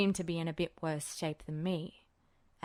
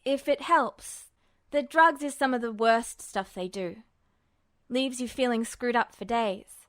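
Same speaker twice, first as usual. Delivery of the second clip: put-on higher, louder defensive young guy; young man voice; 5 semitones higher, 8 dB louder than usual. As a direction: brush it off, loud and forced casual. Narrator voices Ed.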